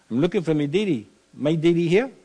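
put-on high Bahamian dialect, a boy calling for his daddy; lightly voiced Eleutheran Bahamian child; Bahamian island accent. [name] retelling his boyhood knock on the door, Bahamian accent. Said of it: "[name] went into that accent over there".

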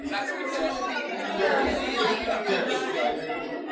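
breathless wheeze of laughter; silent wheezing laugh. Real, not acted. Still laughing.

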